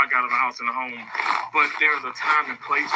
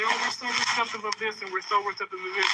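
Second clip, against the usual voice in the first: higher, softer sing-song mockery of managers; mocking the bosses' claim of openness, higher and softer; mocking quote; 8 semitones higher, 5 dB quieter than usual. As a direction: parrot their empty reassurance higher and softer. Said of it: "I know you guys say".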